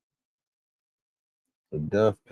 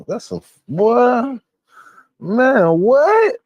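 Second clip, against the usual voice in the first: much higher and louder, stunned what; outraged shock; 7 dB louder, much slower than usual. Real, not acted. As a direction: explode in disbelief. Outraged at the crime.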